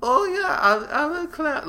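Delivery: very high, breathy-free falsetto squeak; effeminate clown voice. Retold or imitated. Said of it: "do you remember the street clown dude's voice".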